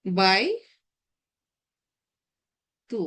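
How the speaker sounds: বাই and তু drawn apart; slow recitation demo. Shown as a demonstration. Teacher recalls the earlier reading of আল বাইতু. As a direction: pause between বাই and তু, recite slowly. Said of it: "আগেরটা বলেছিলাম আল".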